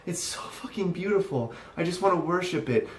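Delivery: hammed-up swooning reverence; mock adoration. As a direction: pretend to be enraptured, dripping with sarcasm. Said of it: "I don't see it as".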